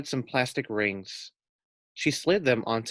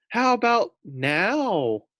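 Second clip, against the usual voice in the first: higher, slow, drawn-out sarcasm; sarcastic pig; 9 semitones higher, 4 dB louder, much slower than usual. A narrator's reading. Pig sarcastically urging the boys to hurry, higher and slow. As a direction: drag it out pointedly.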